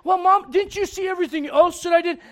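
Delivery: put-on whiny teen. Kid argues with mom.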